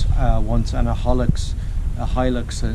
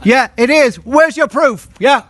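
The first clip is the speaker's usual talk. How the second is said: loud sharp voice; 12 semitones higher, 5 dB louder than usual. Real, not acted. Angry confrontation over property.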